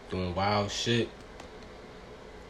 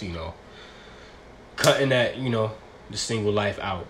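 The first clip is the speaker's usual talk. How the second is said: ordinary talk, nothing marked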